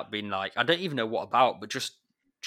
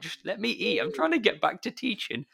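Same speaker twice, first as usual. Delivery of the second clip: much higher, mock-pleading voice; exasperated plea; 12 semitones higher than usual. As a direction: beg the watching kids to let you eat. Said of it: "And you're like".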